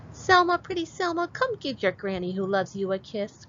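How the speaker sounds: put-on high, sweet old granny calling. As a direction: coo like a loving grandmother. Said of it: "cried Granny".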